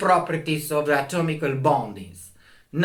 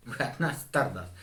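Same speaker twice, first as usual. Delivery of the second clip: said through a laugh, softer and quick; chuckling, faster and softer; 4 semitones lower, 9 dB quieter, faster than usual. Real, not acted. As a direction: laugh it off as you repeat it. Repeats his claim, laughing.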